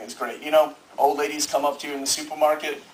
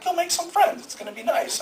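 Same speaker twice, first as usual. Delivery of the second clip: much higher, sing-song mom voice; imitating mom; 14 semitones higher than usual. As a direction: chirp it like an encouraging mother. Mom sending kid to preschool.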